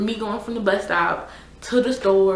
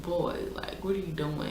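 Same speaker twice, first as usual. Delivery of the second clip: hushed, breathy near-whisper; a quiet aside, half-whispered; muttered under breath; 9 dB quieter than usual. Retold or imitated. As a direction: mutter it to yourself in disbelief. Muttering at the pushy guy.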